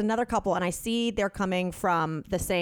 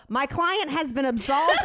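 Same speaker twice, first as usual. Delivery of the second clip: mock advocate; 6 semitones higher, 3 dB louder than usual. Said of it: "you're on a political campaign".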